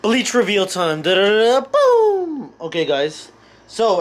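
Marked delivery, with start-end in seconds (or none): 1.0-2.5 sung-out drumroll climbing to a high, long boom; vocal drumroll. Pretend. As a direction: imitate a drumroll and crash for a reveal. Drumroll for the bleach reveal.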